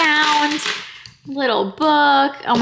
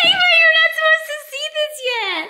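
much higher, laughing through the words; delighted laughing surprise. Real, not acted. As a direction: mom to daughter, squealing with laughter. Mom surprised by daughter Ava.

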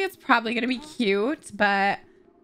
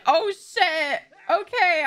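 raised-pitch mock panic; acted dismay; 4 semitones higher, much slower than usual. Victor arrives at the birth, acted dismay. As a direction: blurt it out as a startled husband.

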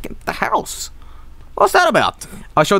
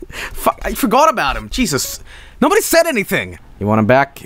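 ordinary talk

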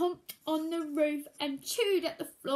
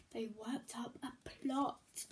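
slow conspiratorial whisper; 4 semitones lower, 7 dB quieter than usual. A narrator's reading. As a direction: hush it like a secret plan. Secret plot.

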